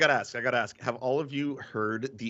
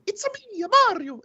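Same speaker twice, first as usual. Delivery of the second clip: very high-pitched cartoon catchphrase; sky-high cartoon game voice; Mario sixty-four; 4 dB louder, faster than usual. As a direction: squeal it like a video game plumber. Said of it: "it sounds like Mario sixty-four".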